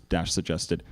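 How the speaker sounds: ordinary delivery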